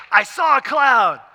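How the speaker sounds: high, drawn-out acted cry; excited announcement. Imagined servant's hoped-for announcement.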